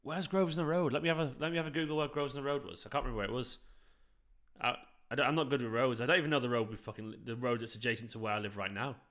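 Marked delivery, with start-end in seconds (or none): none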